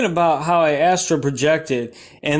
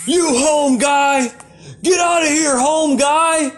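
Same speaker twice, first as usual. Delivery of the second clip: angry homeless guy; 7 semitones higher, slower than usual. Retold or imitated. Homeless guy yelling at a passerby, mock angry shout. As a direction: bark him away like an angry stranger. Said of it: "and he's like".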